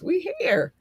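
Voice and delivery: high voice welling up with joy; emotional, happy tears. Real, not acted. Host moved by five years in, tearfully happy. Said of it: "I'm getting emotional".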